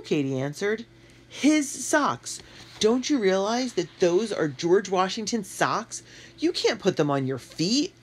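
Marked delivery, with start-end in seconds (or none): 1.3-7.9 horrified. A narrator's reading. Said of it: "Q was horrified".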